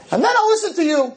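high, fast sarcastic jab; sarcastic retort; mocking challenge. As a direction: tease him with a mock-high voice. Rabbi mocks the atheist.

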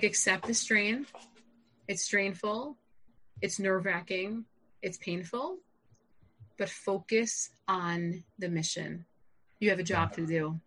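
calm brother laying it out unhurriedly; typical calm. Retold or imitated. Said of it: "his very typical calm way".

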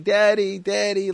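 put-on loud, high nagging kid; kvetching child. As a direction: imitate a whining child pestering Dad. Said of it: "like, levels of fetch".

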